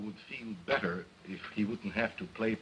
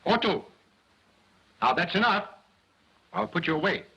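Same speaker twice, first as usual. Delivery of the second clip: louder scolding; 8 semitones higher, 5 dB louder, faster than usual. Acted. Dummy threatened.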